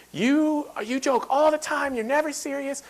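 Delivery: a mock scolding mom voice, high; teasing mom. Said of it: "my mom was here right now, she'd be like".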